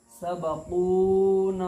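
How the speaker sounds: सबकूना slow and loud, the long vowels held; stretched madd recitation. Shown as a demonstration. Teacher models the correct reading of सबकूना, slow and loud. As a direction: draw out कू and ना in सबकूना. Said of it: "कैसे पढ़ेंगे?".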